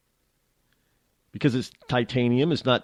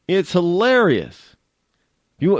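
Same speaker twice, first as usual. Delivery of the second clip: ordinary talk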